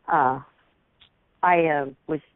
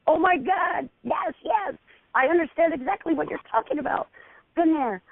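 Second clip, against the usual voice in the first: high, quick, eager agreement; excited gushing; 11 semitones higher than usual. Retold or imitated. Retelling her gushing reply to a friend's confession, high and eager. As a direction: gush with recognition, much higher. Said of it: "And I'm like".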